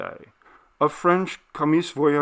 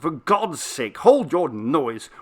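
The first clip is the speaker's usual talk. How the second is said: cried out; 4 semitones higher, 4 dB louder, slower than usual. Acted. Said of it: "I cried, hardly less loud".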